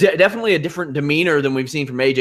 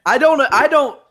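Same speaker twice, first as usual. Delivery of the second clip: high, laughing mock wrestler threat; mock outraged promo; 9 semitones higher than usual. Host fires back at the cat joke, hammed-up laughing wrestler threat. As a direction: ham up the indignant heel promo.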